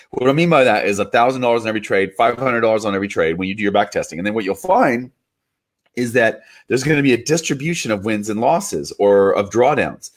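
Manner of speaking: ordinary talk, nothing marked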